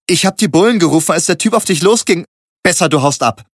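high, loud, fast urging; 1 urgent warning. A narrator's reading. Jas urgently tells the narrator to flee. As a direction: hurry him out the door.

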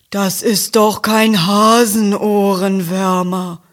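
chewing cow voice; smacking cow. A narrator's reading. Rosa the cow correcting Ferdinand, lip-smacking. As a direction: say it while smacking your lips. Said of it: "schmatzt Rosa".

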